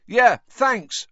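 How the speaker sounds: loud, high, brief call back; acted offhand shout; shouted over shoulder. A narrator's reading. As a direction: toss a shout behind you. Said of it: "Ron shouted over his shoulder".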